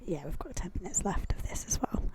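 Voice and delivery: soft whispered aside; whispering. Real, not acted. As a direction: whisper it off mic.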